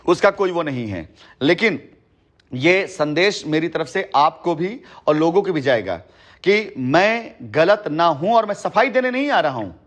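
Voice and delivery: ordinary talk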